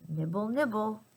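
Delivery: ordinary delivery, nothing marked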